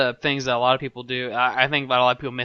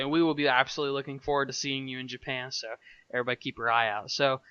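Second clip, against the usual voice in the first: ordinary talk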